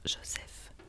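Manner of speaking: usual speaking voice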